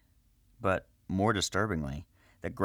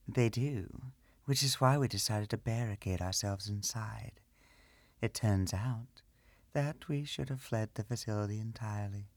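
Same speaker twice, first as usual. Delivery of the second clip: a hint of a higher, softer woman; soft higher priestess voice; lightly voiced priestess; 4 semitones higher, 4 dB quieter than usual. Veronica voiced by narrator.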